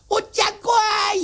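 mock child's crying wail; a kid's sobbing cry of fright. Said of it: "言うて泣いて".

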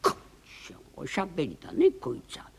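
ordinary delivery

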